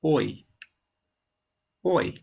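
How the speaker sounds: "Oi" repeated with a big mouth movement; oi sound. Shown as a demonstration. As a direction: show the glide in "Oi" twice. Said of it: "Have a look".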